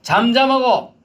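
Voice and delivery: high, loud rebuke; stern command. Acted. Jesus rebuking the demon, loud and stern. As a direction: command silence firmly. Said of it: "그러면서 뭐라고 했어요".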